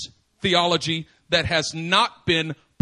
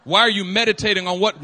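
ordinary talk, nothing marked

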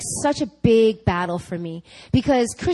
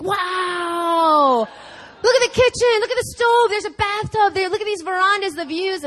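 much higher, louder, gushing over the kitchen; excited husband; 9 semitones higher, 4 dB louder than usual. Retold or imitated. Husband touring the apartment, raving in a high, loud, excited voice. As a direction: rave about every room.

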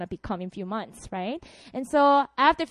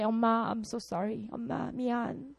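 quiet apology; 2 semitones lower, 8 dB quieter, much faster than usual. Retold or imitated. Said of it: "I had to say".

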